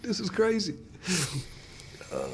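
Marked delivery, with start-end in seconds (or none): 0.0-0.8 higher, incredulous exclamation; amazed disbelief. Retold or imitated. Pastor added to a grieving family's group text, amazed and higher. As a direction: marvel at how it turned out. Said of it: "I was like".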